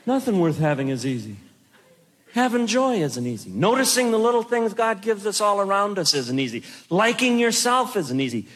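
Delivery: ordinary talk, nothing marked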